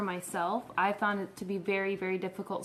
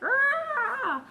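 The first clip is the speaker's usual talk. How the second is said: high, drawn-out witch wail; mock witch screech; melting witch cry; 4 dB louder than usual. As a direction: wail like the witch disappearing in The Wizard of Oz. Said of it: "that witch inside of me is just like".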